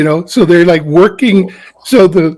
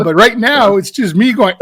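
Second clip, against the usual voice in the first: ordinary talk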